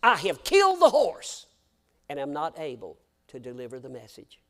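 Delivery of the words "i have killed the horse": lightly voiced Irish brogue, loud lament; a hint of the brogue on the cry. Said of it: "I can say it with the brogue".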